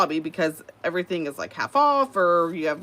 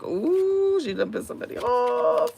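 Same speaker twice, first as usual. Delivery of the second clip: sassy aside; 6 semitones higher than usual. Retold or imitated. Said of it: "I was like".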